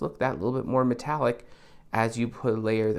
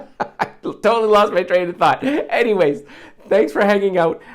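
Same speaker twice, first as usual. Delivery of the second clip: real laugh breaking into loud, higher speech; 8 semitones higher, 9 dB louder than usual. Real, not acted. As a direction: laugh loudly at losing your train of thought. Laughs after losing his thread.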